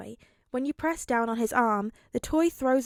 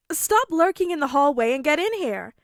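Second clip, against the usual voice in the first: shouting; 5 semitones higher, 8 dB louder than usual. Acted. Mirio calling out.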